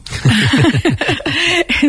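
real chuckle in a rapid string; amused laugh. Real, not acted. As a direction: burst out laughing at a joke. Amused by the footballer remark.

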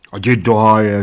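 mildly annoyed brush-off; fed up. A narrator's reading. Bald giant to hiccuping friend, mildly fed up. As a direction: wave him away, a touch irritated. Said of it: "řekl otráveně obr".

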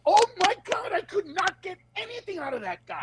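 high exasperated whine; high whiny defeated comic; frustrated comedian. Retold or imitated. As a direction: moan that nothing worked on him. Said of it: "he'll get off and go".

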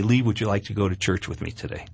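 wife gently inviting her hungover husband to church, softly voiced; gentle ask. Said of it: "she said very gently to me".

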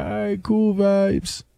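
high, slow Jamaican patois; Jamaican dialect. Retold or imitated. Answering the pilot in kind, imitated Jamaican accent. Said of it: "speak Jamaican".

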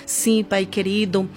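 normal talking voice

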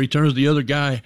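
ordinary talk, nothing marked

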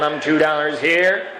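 ordinary delivery

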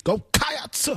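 "Cats" stretched into ka-ahts, rising; "Cats" drawn out like a preacher. Shown as a demonstration.

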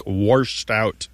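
"washed" said as warshed; intrusive R. Shown as a demonstration. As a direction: add an R before the sh in "washed". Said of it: "mention that the creek washed out".

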